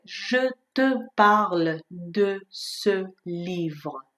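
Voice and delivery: "Je te parle de ce livre" spoken very slowly, each schwa clear; slow example sentence. Shown as a demonstration. French teacher reading an example to students: slow and clear. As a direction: read it out slowly and carefully. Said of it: "یه جمله که توش از اینا زیاد داره".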